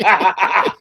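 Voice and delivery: ordinary delivery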